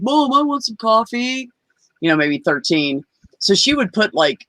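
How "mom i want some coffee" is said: put-on high, drawn-out kid begging mom; pleading kid. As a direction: imitate a child wheedling, pitched up and slow. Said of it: "eleven, twelve-year-old age, you're like".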